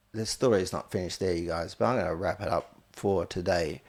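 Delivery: usual speaking voice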